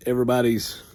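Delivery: ordinary delivery, nothing marked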